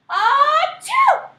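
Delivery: huge hammed-up sneeze, loud and high; big sneeze. Wolf blasting a giant sneeze at the house. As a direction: let the sneeze explode.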